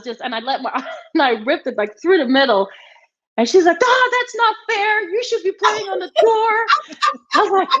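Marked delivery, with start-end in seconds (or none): 3.8-6.7 high-pitched mock outrage; retold indignant protest. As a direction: imitate a sore opponent complaining, pitched way up. Imitates opponent's heated complaint.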